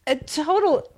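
high giggling through words; laughing uncontrollably; hiccup giggles. Real, not acted. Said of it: "I can't stop laughing".

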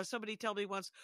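ordinary talk, nothing marked

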